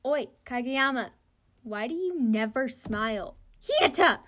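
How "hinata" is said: loud call of Hinata; shouted name. Acted. A teammate calling out to Hinata, shouted. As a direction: yell his name across the court.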